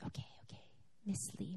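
soft whisper while checking records; breathy murmur, not for the caller; whispering to herself. Retold or imitated. Office lady whispering to herself as she looks up the records.

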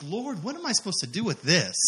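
acted helpless complaint; bewildered plea. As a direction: plead it with the pitch rising and falling.

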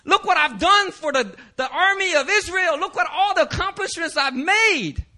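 high-pitched, hammed-up wailing; mock crying plea. Condemned Joab begging at the altar for his life, mock crying. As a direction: beg for mercy in an exaggerated high crying voice. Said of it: "he's crying, he's like".

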